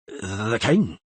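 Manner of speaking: halting, stammered start; stammering. A narrator's reading. Earl answering the angry queen, stammering. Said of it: "stammered Crach".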